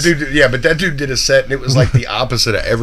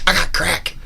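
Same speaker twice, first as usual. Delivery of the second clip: soft, throwaway mimicry; casually random; 3 semitones higher, 5 dB quieter, slower than usual. Comic retelling another comic's aside, casual and soft. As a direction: toss it off softly out of nowhere. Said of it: "he said it so casually random".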